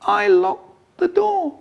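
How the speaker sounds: very high, slow, feeble confession; tiny, pitiful high voice; weedy little voice. Acted. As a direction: squeak it out like a guilty child. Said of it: "In a weedy little voice".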